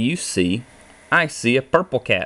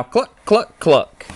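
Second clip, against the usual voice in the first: high chicken clucks, much higher; clucking hen; 9 semitones higher, 4 dB louder, slower than usual. A narrator's reading. Reading a barnyard book to kids, high hen clucks. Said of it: "Spin with the chickens now".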